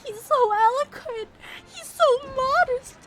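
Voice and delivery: high loud fangirl swoon; He's so modest, almost crying with delight. Real, not acted. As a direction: gush over him breathlessly. Swooning over character.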